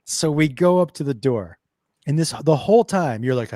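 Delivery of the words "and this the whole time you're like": ordinary talk, nothing marked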